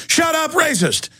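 high-pitched sneering mock shout; mocking accuser. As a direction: squeal it like a hysterical accuser.

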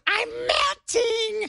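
very high, slow melting wail; mock death wail. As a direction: stretch it out as a hammed-up dying cry. Mimics a villain's melting death.

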